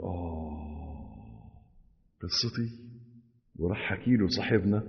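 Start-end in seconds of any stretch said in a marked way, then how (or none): 0.0-2.8 a drawn-out, much softer sung sigh; mock wistful sigh. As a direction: sigh before a long tale. Sighing before telling story.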